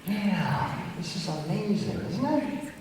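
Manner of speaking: a soft, lightly voiced parrot puppet; macaw puppet voice. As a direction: let the puppet bird chime in quietly.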